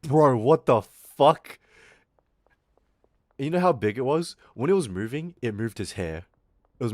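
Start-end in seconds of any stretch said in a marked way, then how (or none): 0.0-1.6 loud, slow, drawn-out outburst; shocked disbelief. Retold or imitated. Reacting to the giant louse in a friend's hair, loud and shocked. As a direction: recoil at the sight. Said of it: "And I was like".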